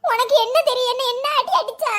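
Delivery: high, tearful complaint; crying. Acted. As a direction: wail it through tears like a hurt child. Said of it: "ஏடி, அழாதட்டி".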